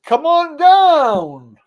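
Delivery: drawn-out, high, loud game show call; game show host. Retold or imitated. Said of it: "It sounds like bloody".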